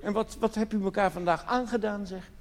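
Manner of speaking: ordinary delivery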